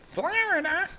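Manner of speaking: Florida stretched way up high in a mock New Jersey accent with a rising end; hammed-up New Jersey accent, squealing the name Florida; playful New Jersey accent jab at Florida. Shown as a demonstration. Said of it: "New Jersey accent".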